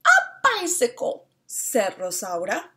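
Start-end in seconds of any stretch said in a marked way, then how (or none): 0.1-1.3 higher, louder eager hen. Acted. Rosaura telling Señora Amelia her wish, eagerly. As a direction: chirp it out with delight.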